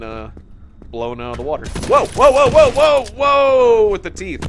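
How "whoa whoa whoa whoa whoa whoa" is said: loud, high string of startled cries; alarmed shouting. Real, not acted. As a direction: yell out in sudden alarm. Startled by attack in game.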